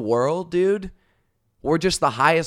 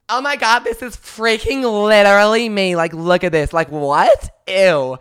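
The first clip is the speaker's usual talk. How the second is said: put-on high, loud squealing girl voice; mocking valley girl; 8 semitones higher, 8 dB louder than usual. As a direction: squeal it like a silly teen girl. Imitating the girl's squeal.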